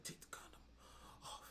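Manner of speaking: breathy whisper in his ear. Retold or imitated. Retelling a girlfriend whispering in his ear. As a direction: whisper it close and low.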